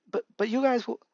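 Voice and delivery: lightly acted hesitation, restarting the words; hesitant Mirio. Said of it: "Mirio hesitantly said".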